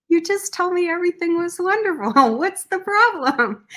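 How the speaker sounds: laughing disbelief. Retold or imitated. Speaker answering the veteran's one problem, amused laughing protest. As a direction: laugh through the mock complaint.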